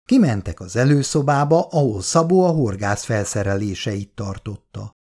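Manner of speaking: ordinary delivery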